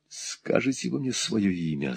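hushed, held-back demand; choked voice. A narrator's reading. Nicholas to his enemy, demanding a name, in a choked voice. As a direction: ask it softly, voice held tight. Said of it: "сдавленным голосом спросил Николас".

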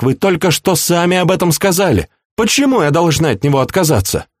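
high-pitched, indignant princess; exclaiming protest. A narrator's reading. Princess defending her beloved to her uncle, exclaiming in protest. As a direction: argue back in a high, insistent voice. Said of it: "воскликнула она".

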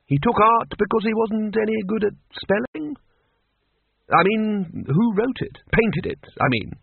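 a lightly voiced Arch-Chancellor. A narrator's reading. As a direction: bluff wizard thinking aloud. Ridcully puzzling aloud.

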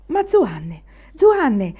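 pressing, rising to a loud high repeat; insisting. A narrator's reading. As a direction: insist on the name. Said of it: "insisteva Anania". (insistente, la seconda volta più forte e acuta)

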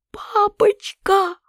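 very high, drawn-out cry of horror; a girl crying out to her father in horror; terrified cry. A narrator's reading. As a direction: wail «Папочка!» slowly, in terror. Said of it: "закричала я в полном ужасе".

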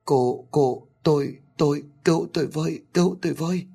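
high, eerie ghostly cry for help; a far-off spirit begging to be saved; ghostly plea. Acted. Tiếng kêu cứu ma quái mở đầu. (giọng ma nữ cao vút kêu cứu)

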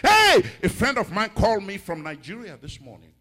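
high, loud "hey" easing down; excited preacher announcing news; excited exclamation. Real, not acted. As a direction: burst into the testimony with a cry. Exclaims, launching a testimony.

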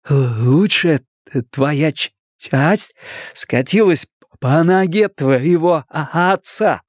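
slow, halting stammer; effortful stuttered comeback; stuttering boy. A narrator's reading. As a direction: get stuck on first sounds, then push through. Stuttering boy trades insult.

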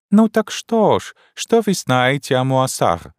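put-on high, slow, broken-Russian old Frenchwoman; a bewildered elderly French wife, high and slow; old Frenchwoman puzzled by her husband; old French lady. Old French lady's lines.

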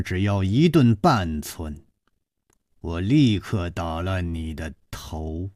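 low, soft, icy threat; a woman's hushed deadly warning to a crouching man; cold and hard. Acted. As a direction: menace him quietly, cold as steel. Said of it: "冷冷地说".

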